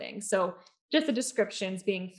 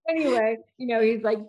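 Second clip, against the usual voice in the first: ordinary delivery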